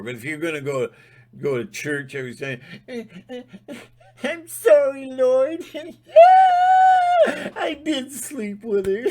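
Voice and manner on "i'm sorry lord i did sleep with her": high, loud hammed-up wailing to the Lord, laughing through it; mock sobbing confession. Mock churchgoer wailing his sin to the Lord, hammed-up sobbing. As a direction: wail a fake tearful apology.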